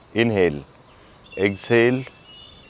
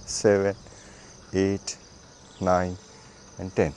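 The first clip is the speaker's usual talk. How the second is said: low count, softening towards the end; exercise counting; 4 semitones lower, 5 dB quieter than usual. Acted. Instructor counting for another leg, steady low count. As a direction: count steadily, one beat per repetition.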